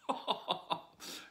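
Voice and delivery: airy breathless chuckle; breathy laugh. Real, not acted. Reader laughs mid-story.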